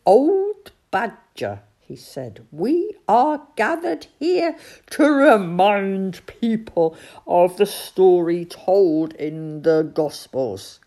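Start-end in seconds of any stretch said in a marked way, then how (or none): 0.0-1.6 slow, loud, high address; spoken slowly into a deaf ear. A narrator's reading. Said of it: "spoke slowly into it". 2.5-10.8 loud, measured announcement; slow. A narrator's reading. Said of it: "spoke slowly into it".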